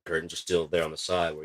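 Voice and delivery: usual speaking voice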